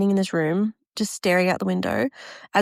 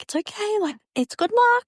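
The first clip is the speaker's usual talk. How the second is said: much higher, sing-song consoling voice; reassuring guests; 12 semitones higher than usual. Retold or imitated. Wedding guests to the bride as rain pours, high reassurance.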